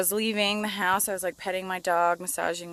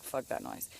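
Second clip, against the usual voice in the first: soft, breathless grumpy dog voice, much softer; dog's grumpy voice; 6 semitones lower, 10 dB quieter than usual. Retold or imitated. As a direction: mutter it as a fed-up old dog would. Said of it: "Now he's like".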